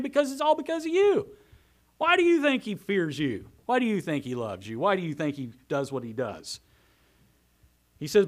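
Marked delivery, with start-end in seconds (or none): 0.0-6.6 loud sarcastic accusation, starting high then dropping; adamant, sarcastic Satan. Acted. Satan challenging the Lord over Job, adamant and sarcastic. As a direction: throw mocking questions at the Lord, leaping up at first. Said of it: "Adamant, sarcastic".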